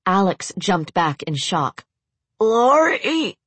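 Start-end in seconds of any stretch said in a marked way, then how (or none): 2.4-3.4 high, strained rasping cry; rasping call. Acted. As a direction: rasp out her name in pain. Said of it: "Raymond rasped".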